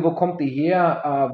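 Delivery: normal talking voice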